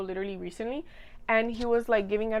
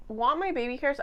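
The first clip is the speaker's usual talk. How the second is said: usual speaking voice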